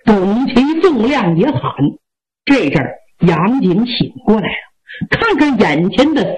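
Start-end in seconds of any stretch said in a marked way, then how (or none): none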